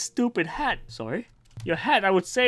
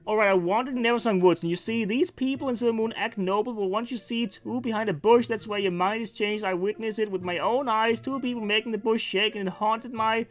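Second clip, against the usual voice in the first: in-character storytelling; 3 semitones higher, 4 dB quieter than usual. Acted. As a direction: recount a scandalous tale softly, in an unbroken flow. Tells tale in character.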